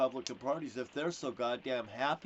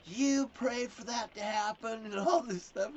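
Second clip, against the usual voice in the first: higher mock-angry mom voice; mom in a rage; 9 semitones higher than usual. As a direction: play his enraged mother, pitched up. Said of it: "My mom was in a rage".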